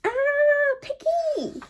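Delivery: very high startled cry of a name; startled squeal. Acted. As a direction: squeal it out in sudden alarm. Elephant startled by bird.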